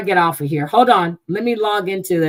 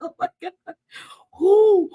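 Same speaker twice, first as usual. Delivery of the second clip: much higher, breathless, giggling squeals; real excitement; 12 semitones higher than usual. Real, not acted. Streamer overjoyed at Island Girl's gift, high giddy squealing. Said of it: "I'm so excited".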